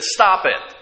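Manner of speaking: a mild, clipped sharp command; a touch of sternness aimed at the wind; sharp rebuke. Acted. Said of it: "he spoke sharply to it".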